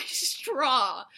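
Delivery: normal talking voice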